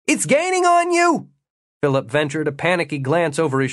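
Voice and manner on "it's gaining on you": high-pitched urgent yell; shouted warning. A narrator's reading. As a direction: call it out in alarm to a runner far off. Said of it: "Jimmy shouted".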